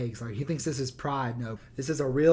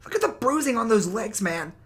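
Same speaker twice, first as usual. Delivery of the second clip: mock Joe Rogan; 7 semitones higher than usual. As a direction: parody him squeaky and smug. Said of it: "he's like".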